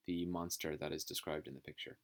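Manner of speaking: normal talking voice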